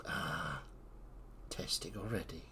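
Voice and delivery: low, soft voice from the tunnel; disembodied voice. Acted. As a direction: speak low and quiet as an overheard voice. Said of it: "you hear a voice go".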